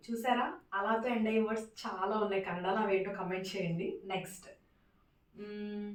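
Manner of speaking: ordinary talk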